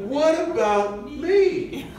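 high, laughing mock-hurt wife; imagined wife's plea. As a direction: ham up a wife who feels forgotten. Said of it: "She's thinking".

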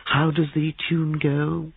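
high, drawn-out musical query; teasing young man. Acted. Christopher teases with a tune.

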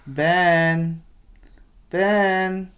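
building from firm call to loud high shout. Acted. Miss Green calling the daydreaming Ben, building to a shout. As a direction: call his name until he snaps to. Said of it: "Miss Green says".

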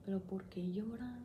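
hushed, puzzled whisper; whispered disbelief. Retold or imitated. She recalls wondering why movie mothers cry, whispering. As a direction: whisper it like a private doubt. Retelling her old thoughts on movies.

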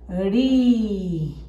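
RI drawn out slowly with a soft tapped R; soft R syllable. Shown as a demonstration. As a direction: stretch RI gently, letting the vowel hang. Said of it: "Suena suavecita".

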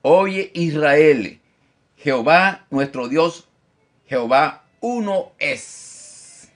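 slow, loud, drawn-out proclamation; solemn scripture reading. Acted. Preacher reading the verse aloud to his church, slow and solemn. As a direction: proclaim each word slowly to the congregation.

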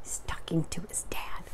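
hushed explanatory whisper; whispered aside. Real, not acted. Reader explains who is talking to whom, whispered.

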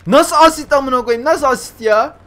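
high, loud incredulous complaint; outraged protest. Real, not acted. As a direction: shout in disbelief at the unfair score. Protests the game's assist ruling. (isyan ederek, yüksek sesle)

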